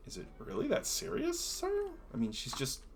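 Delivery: high, doubtful guard questioning his boss; a nervous guard pushing back politely. Acted. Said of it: "one of the guards looks over to him and says".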